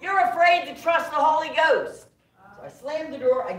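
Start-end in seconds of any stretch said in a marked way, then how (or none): 0.0-2.1 1 retold holler. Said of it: "I hear him holler".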